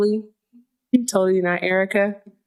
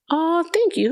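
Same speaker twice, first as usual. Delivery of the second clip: excited thanks; 10 semitones higher than usual. Real, not acted.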